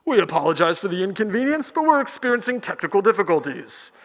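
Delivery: put-on public-address announcer; park announcer voice. Announcer as the dragon should appear, formal apology. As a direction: make a formal apology over the park speakers. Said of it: "the voice comes on".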